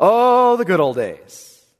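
mock nostalgia. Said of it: "They weren't that good".

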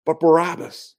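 high-pitched, forceful crowd cry; imitated mob shouting. Crowd rejects Jesus before Pilate, imitated high shouting. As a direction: call it out high like a crowd demanding a verdict. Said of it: "Now they're shouting".

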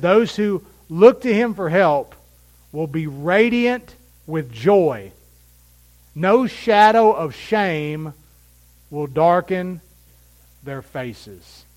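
slow, loud, lifted scripture reading; emphatic scripture reading. Acted. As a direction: read the verse as a proclamation.

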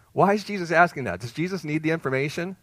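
normal talking voice

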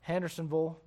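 Tennessee "Hendersonville" ending in a short -vul; Tennessee accent. Shown as a demonstration. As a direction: say "Hendersonville" like a Tennessee local, -ville swallowed to vul. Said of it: "people talk a little bit different there".

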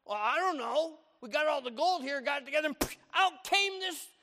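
fumbling excuse to Moses; Aaron excusing himself; Aaron's excuse. Retold or imitated. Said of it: "And he says to Moses".

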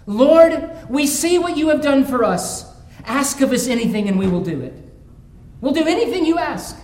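loud, high devoted cry to the Lord; Israel's fervent prayer. Acted. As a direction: call out the prayer of a grateful people. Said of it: "should be this".

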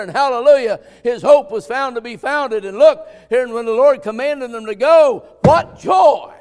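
loud, high-pitched revival preaching, building to a shout; preaching at full force. Real, not acted. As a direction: preach with mounting fervor until you shout.

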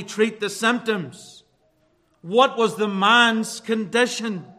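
forceful pulpit preaching. Acted. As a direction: drive each point home from the pulpit.

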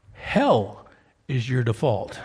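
hard-truth pulpit emphasis; emphatic statement. Real, not acted. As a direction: punch Hell, then drop. Preacher stressing the hard truth.

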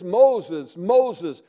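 loud calling of a name, twice; a loud divine call. Acted. God calling Moses by name.

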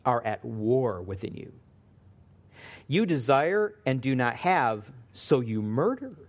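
low, measured Bible reading; solemn scripture read to the congregation. Acted. Reads James chapter four aloud.

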